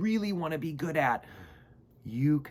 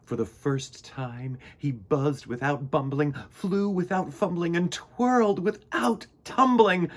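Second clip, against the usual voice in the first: lively read-aloud narration building up; storybook narration; 3 semitones higher, 4 dB louder than usual. A narrator's reading. Storyteller reading Mister Bumble's tale to children. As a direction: read it like a picture book for kids.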